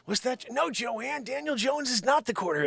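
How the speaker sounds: ordinary talk, nothing marked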